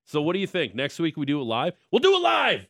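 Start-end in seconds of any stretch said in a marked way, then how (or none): 1.9-2.7 much higher, louder mock outburst; high-pitched, hammed-up TV host shout; Bill O'Reilly. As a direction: yell it as a quoted on-air blowup. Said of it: "in the words of Bill O'Reilly".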